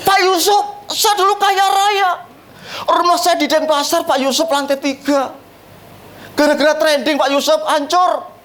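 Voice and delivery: a lightly voiced wailing widow; wailing rich woman. Rich woman laments her ruin. (tiruan ratapan wanita kaya)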